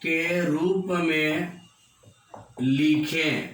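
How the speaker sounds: very slow, drawn-out words with long pauses; word by word, stretched out at writing pace; slow dictation. Real, not acted. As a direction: read it out slowly as you write it. Writing out the example aloud.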